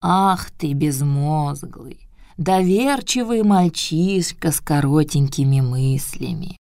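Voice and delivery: put-on slow old scolding voice; old turtle scolding. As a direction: scold slowly like an old grandmother. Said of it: "сказала Тортила".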